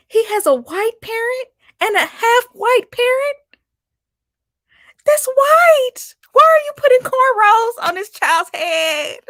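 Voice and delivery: squealing in disbelief, way up high; high-pitched incredulous. Real, not acted. Ranting to viewers about the cornrows, high-pitched incredulous. Incredulous rant cracking up over the baby.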